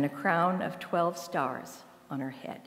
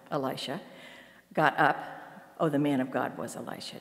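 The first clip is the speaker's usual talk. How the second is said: ordinary talk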